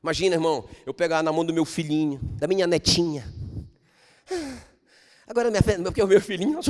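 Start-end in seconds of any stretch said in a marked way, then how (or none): none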